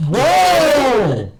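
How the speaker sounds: high loud whoa; surprised shout. Real, not acted. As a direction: react with sudden shock. Reacting to shirt off.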